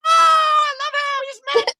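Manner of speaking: very high, giddy mock Anna voice; Anna quote. Brother mocks Anna gushing over her fiancé, in a very high giddy voice. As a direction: squeal it like a lovestruck girl.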